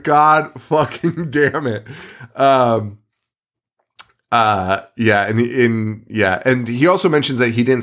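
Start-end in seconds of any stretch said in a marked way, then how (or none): 0.0-1.9 drawn-out, raised exasperated curse. Retold or imitated. Friend reacting to someone bursting into the room, exasperated. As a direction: groan it out slowly at the intruder. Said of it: "And you're like".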